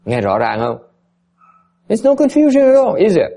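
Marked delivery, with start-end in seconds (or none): 1.9-3.3 much higher and louder, rising with zeal; almost exclaim it to the class; teacher overcome with conviction; excited insistence. Real, not acted.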